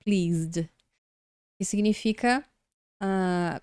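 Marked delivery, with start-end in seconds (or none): 0.0-0.7 pleased ending in a voiced zd; one careful syllable on pleased, silent e before d; pronúncia de pleased. Shown as a demonstration. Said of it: "É o Z e o D". (pleased com som de Z e D)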